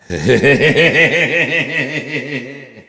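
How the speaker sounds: long, loud, high-pitched laugh; loud high cackle. Real, not acted. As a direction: burst out cackling. Big laugh after joke.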